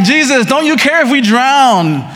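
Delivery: high, loud desperate cry; begging a sleeping teacher in mock panic; panicked plea. Disciples crying out to Jesus, mock fear. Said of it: "so they're like".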